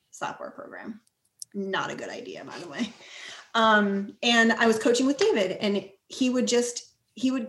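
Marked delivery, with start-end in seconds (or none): 0.1-2.9 half-swallowed wry confession, almost breathless; whispered aside. Real, not acted. Admitting to the host the app idea failed, whispered aside. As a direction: drop to a hush for a rueful joke.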